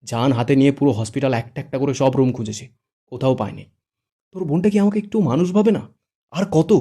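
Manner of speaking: loud, high, pained complaint; angry outburst. Acted. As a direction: shout out the frustration. Angry outburst over missing sister.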